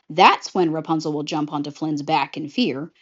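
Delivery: ordinary delivery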